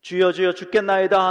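desperate shout to the sleeping master; panicked cry. Acted. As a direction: cry out for help as the boat sinks. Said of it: "이십사 절 이십오 절을 한번 보십시오".

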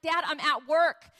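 fed-up daughter on the phone; annoyed. Retold or imitated. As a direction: protest that you're busy. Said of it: "I get annoyed 'cause he calls me so much".

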